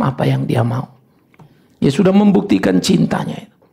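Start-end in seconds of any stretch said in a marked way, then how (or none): none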